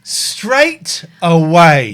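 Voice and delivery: loud, hugely drawn-out words; exasperated outcry. Real, not acted. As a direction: stretch each word out in loud disbelief. Still exasperated at the instant loss.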